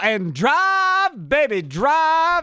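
drawn-out hype yell; excited shout. Acted. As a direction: belt it like a pitchman. Hyped ad call to drive.

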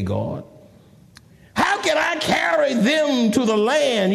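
high, slow protest, laying it all out; indignant plea that starts high; exasperated Moses. Acted. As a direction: argue with God, stretching the words. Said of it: "look how he talking to God".

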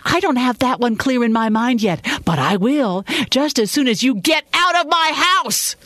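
high-pitched furious rant building to a scream; angry screaming. A narrator's reading. Tearful woman ordering him out, screaming in anger. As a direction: build to throwing him out of the house. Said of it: "She kept screaming".

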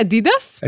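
Adidas stressed on DEE, a-DEE-das; middle-stressed Adidas with a long ee; own pronunciation of Adidas. Shown as a demonstration. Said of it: "How do you say it?".

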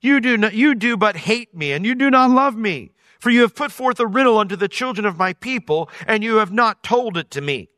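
hammed-up weepy accusation; mock blubbering. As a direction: plead through sobs like a wounded bride. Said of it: "as she's blubbering like this".

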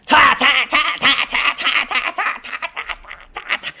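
machine-gun "ター" calls, laughing; comic rattle of stage calls; rapid cheer calls. Retold or imitated. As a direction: fire off cheers without breath. Rapid string of praise calls.

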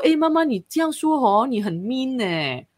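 mother quoting her daughter's cheeky reproach; teasing daughter. Retold or imitated. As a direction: play the daughter gently scolding her mother. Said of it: "她说".

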